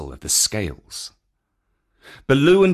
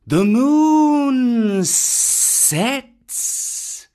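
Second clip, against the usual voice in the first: very slow, high, drawn-out call; Kaa the python; 12 semitones higher, 4 dB louder, much slower than usual. Acted. Kaa addressing the watching monkeys, long eerie python call. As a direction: hold each word like a hypnotist snake.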